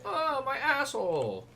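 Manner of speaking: high, loud squeal; whiny Hans. Retold or imitated. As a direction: squeal in mock outrage. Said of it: "And he'll be like".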